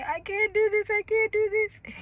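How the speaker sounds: high crying plea; breaking down. Retold or imitated. Mother in labor breaking down to the nurse, crying plea. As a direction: break down in tears. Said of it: "I break down and say".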